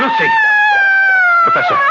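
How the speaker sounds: very high, loud cry of alarm; panicked cry. Acted. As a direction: call out in horror. John finds Lucy, calls out.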